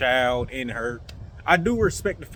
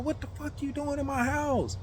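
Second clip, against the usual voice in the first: high, softer mock outrage; imitated indignant woman; 10 semitones higher, 8 dB quieter than usual. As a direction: mimic her startled protest in a high voice. Woman confronts intruder, retold.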